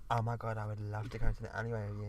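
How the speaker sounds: put-on girl character. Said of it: "I'll go back to character".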